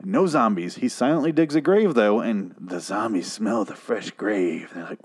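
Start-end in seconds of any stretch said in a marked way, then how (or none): none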